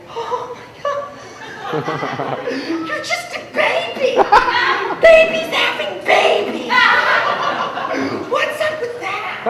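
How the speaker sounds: very high, hushed squeal; mock freaked-out reaction. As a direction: imitate scandalized onlookers in a tiny high voice. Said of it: "People freak out when they find that out".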